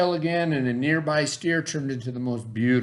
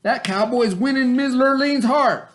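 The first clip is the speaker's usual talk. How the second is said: much higher, louder put-on voice; wicked daddy; 11 semitones higher, 6 dB louder than usual. Wicked daddy ordering his sons, high and loud. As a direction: bark the order at your sons. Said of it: "said their wicked daddy".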